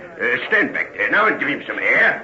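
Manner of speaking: low London constable voice, taking charge; a policeman sorting out an accident; gruff constable. Acted. Policeman voice, deep accent.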